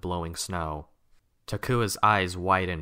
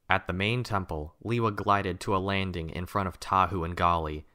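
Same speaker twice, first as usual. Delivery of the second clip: usual speaking voice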